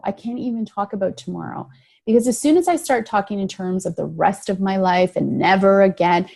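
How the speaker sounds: ordinary talk, nothing marked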